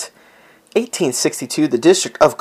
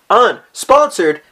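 high, slow, drawn-out announcer word; mock announcer; 9 semitones higher, 4 dB louder, slower than usual. Introducing the word of the day, a hint of an announcer. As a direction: sell it like an ad voice.

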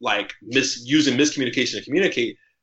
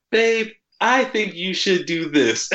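slow, higher-pitched, measured delivery; careful, considerate; 6 semitones higher, slower than usual. Retold or imitated. Girlfriend carefully giving advice to her sensitive boyfriend, gently deliberate. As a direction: imitate a girlfriend choosing each word with care. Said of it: "put in the effort to tell me something".

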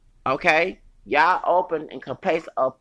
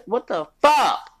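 slow, loud, higher outraged yell; 7 semitones higher, 7 dB louder, slower than usual. Real, not acted. Ranting about a coworker, a slow outraged yell. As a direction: bellow it out in disbelief.

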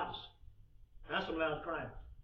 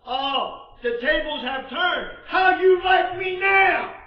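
high, slow, drawn-out taunt; gloating shout; 12 semitones higher, 17 dB louder, slower than usual. Acted. Fleshly man turning the tables on brothers, gloating loudly. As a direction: crow in triumph at full volume. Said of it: "Because the fleshly men say".

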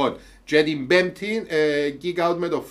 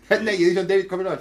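normal talking voice

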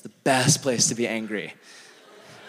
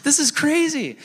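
amazed; 13 semitones higher than usual. Retold or imitated. Retold amazement.

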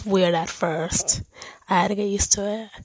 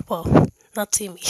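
ordinary talk, nothing marked

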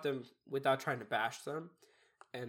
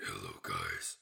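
deep sick voice; 5 dB quieter, slower than usual. Retold or imitated. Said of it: "I would be like".

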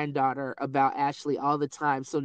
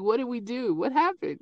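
bewildered; 6 semitones higher than usual. Retold or imitated. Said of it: "looking at each other like".

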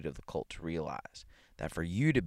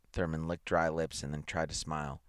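plain reading voice

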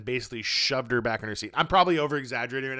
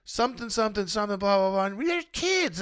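much higher, babbling mock of her complaint; mocking whiny woman; 8 semitones higher than usual. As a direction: squeak it like a nagging complainer.

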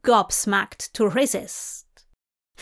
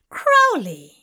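louder, higher delighted cry of the name; light up at the name; delighted exclamation; 5 semitones higher, 5 dB louder than usual. A narrator's reading. Tracy delighted to meet Crowley, louder and higher.

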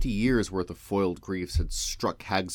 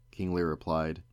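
ordinary delivery

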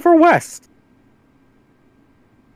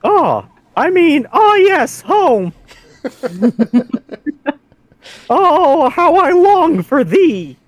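mock yearning; 2 semitones higher, much slower than usual. Mock homesick reply.

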